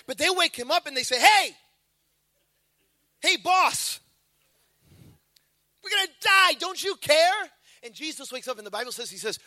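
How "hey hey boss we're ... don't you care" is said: mock panicked disciples. Disciples waking Jesus in storm.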